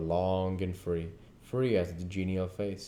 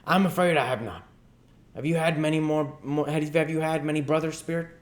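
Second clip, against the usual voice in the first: higher, louder hesitant question; Scrooge voice; 9 semitones higher, 5 dB louder than usual. Acted. Scrooge asks the ghost about brothers, higher and louder. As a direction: ask warily like a cautious old man.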